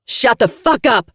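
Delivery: high, loud screech. Acted. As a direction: screech it in fury. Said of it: "she screeches".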